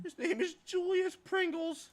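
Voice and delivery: high, slow disbelieving; incredulous. Real, not acted. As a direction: spell out the absurd name slowly. Incredulous repeating the name.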